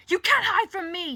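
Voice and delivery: furious high-pitched shout; full-voiced enraged yell. A narrator's reading. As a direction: yell threateningly at someone escaping. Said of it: "he yells".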